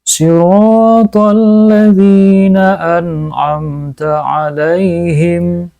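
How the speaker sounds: slow, melodic tajwid chant; Quran recitation. Acted. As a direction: recite it as in prayer, drawing out the vowels.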